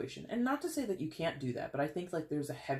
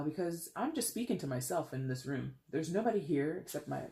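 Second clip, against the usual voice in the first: ordinary talk, nothing marked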